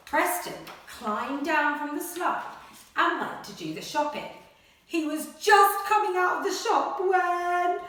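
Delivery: animated picture-book read-aloud; reading to children. Acted. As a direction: read it like a bedtime adventure. Story reading continues.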